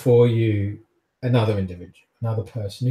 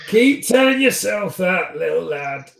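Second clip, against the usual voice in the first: sneering brute, pitched up; mocking thug voice; 7 semitones higher, 4 dB louder than usual. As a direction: taunt him like a bully.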